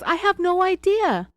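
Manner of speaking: very high, loud, put-on whine; mock crying wail. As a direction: imitate a tearful, wailing denial. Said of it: "and she cried".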